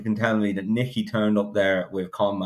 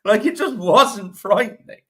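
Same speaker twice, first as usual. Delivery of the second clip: much higher, indignant outburst; exasperated retort; 13 semitones higher, 4 dB louder than usual. Real, not acted. Pundit scoffing at the trainer's claim, high indignant outburst. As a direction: scoff at it, voice jumping way up.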